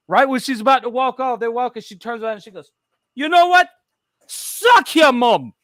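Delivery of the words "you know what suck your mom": high, loud mock taunt; hammed-up shouted comeback; wife's parting insult. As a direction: throw it back loudly, drawing out the last words.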